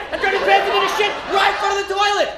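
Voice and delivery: usual speaking voice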